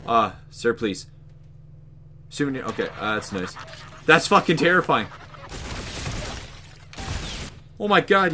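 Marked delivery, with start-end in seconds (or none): 4.1-8.3 real fright. Real, not acted. Scared reaction.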